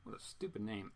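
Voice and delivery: normal talking voice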